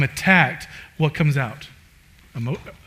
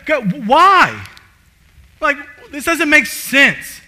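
high, louder whining at God; Jonah's mock complaint; 10 semitones higher, 5 dB louder, slower than usual. Jonah griping at God, high and aggrieved. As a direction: sulk loudly like a child in a store.

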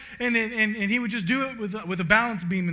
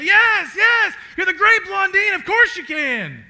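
much higher, louder cheering shout; crowd shouting yes; 13 semitones higher, 13 dB louder, slower than usual. Retold or imitated. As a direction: shout it back like an eager crowd.

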